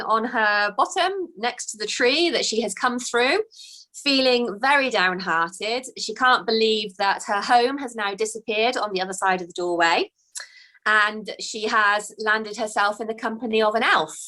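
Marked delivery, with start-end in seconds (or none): none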